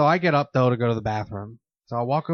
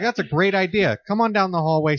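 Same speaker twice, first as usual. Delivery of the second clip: higher, louder mock-agreeable invite; sarcastic; 7 semitones higher, 3 dB louder than usual. Mocking his friend's floor theory, sarcastically cheerful. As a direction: sell the idea with fake cheer.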